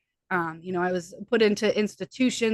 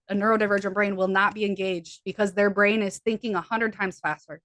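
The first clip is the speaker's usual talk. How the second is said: ordinary talk, nothing marked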